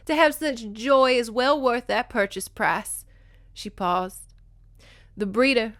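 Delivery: acted-out audiobook dialogue; dramatic story reading. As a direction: read both friends' lines with theatrical earnestness. Reads novel dialogue aloud.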